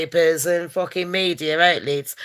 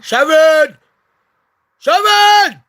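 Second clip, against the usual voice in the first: loud, high call across the house; mock yell for Sharon; 7 semitones higher, 8 dB louder, much slower than usual. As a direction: shout her name like she's upstairs. Calling out to the mother.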